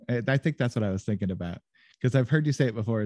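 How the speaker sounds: usual speaking voice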